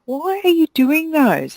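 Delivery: high, puzzled question; incredulous thought. Retold or imitated. Owner wondering at a beautician doing pedicures, incredulous. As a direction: ask it in disbelief. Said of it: "And I'm thinking".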